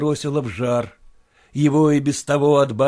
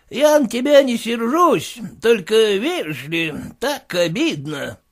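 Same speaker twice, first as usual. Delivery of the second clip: higher, aggrieved merchant voice; aggrieved friend; 9 semitones higher, 4 dB louder, slower than usual. Acted. Goldatin tells Yegor he is hurt, aggrieved. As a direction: sound stung but not angry.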